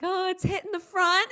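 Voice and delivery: high, hammed-up report of damage; mock-tragic, pitched well up; big dramatic. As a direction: wail it as a teen reporting to dad. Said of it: "like, a big dramatic thing".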